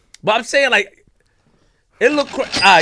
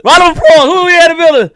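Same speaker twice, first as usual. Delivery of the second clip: loud, high hype-man shout; rally the room for the guest; hyped-up introduction holler; calling for applause; 9 semitones higher, 7 dB louder than usual. Real, not acted.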